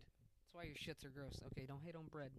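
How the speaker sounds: ordinary delivery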